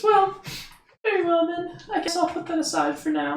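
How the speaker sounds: high, grudging little fairy. Acted. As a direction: give in primly in a tiny voice. Fairy concedes.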